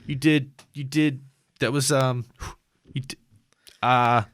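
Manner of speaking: flustered doctor. Acted. Doctor fumbling praise before bolting, flustered. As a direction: play a wired doctor losing his thread.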